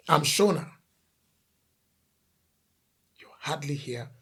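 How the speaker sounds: normal talking voice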